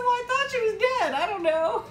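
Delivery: high, fast, giggly defense; laughing self-quote. Retold or imitated. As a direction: laugh while insisting. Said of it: "I said".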